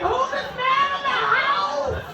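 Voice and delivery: high-pitched, drawn-out alarm; mock panic. Mom hears a stranger in the house, high mock panic. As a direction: act out a startled mom, voice way up. Mom frightened by son's new voice.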